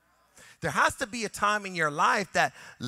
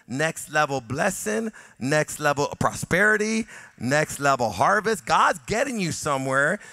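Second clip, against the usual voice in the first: louder, building repetition of next level; full-force preaching; 8 dB louder than usual. Acted. Pastor building to a climax before the video, preaching loud. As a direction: rouse the congregation, rising each phrase.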